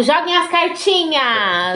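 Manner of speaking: slow, drawn-out show call; segment announcer. Pretend. Host cues the listeners' letters, announcing. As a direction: call in the segment with stretched words.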